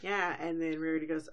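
ordinary delivery

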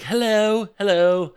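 Peggy Carter's mock upper-crust British hello; posh British hello. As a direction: chirp it like jolly old England. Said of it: "And Peggy Carter's like".

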